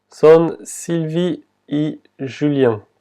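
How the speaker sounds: Son Sylvie y Julien said slowly and clearly; slow translation. Shown as a demonstration. Teacher translating the French line, slowly. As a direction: lay out each word of Son Sylvie y Julien. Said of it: "Ce sont Sylvie et Julien".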